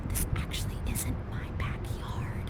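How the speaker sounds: fast, hushed whisper; quick conspiratorial whisper; secret whisper. Real, not acted. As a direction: whisper it so the owners won't hear. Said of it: "we have to be super discreet".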